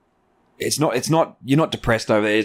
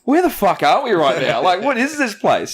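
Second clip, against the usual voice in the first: incredulous; 8 semitones higher than usual. Retold or imitated. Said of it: "And I'm like".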